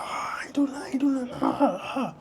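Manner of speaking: high, thin mock scream; imitated panicked scream. Young man grabbed by the officer, imitated freaked-out screaming. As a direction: imitate someone freaking out, pitch way up, voice half gone. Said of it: "he's freaking out. He's screaming".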